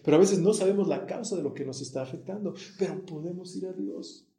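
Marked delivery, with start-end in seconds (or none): none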